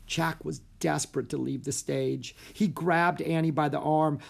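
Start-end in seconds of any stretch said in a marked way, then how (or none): none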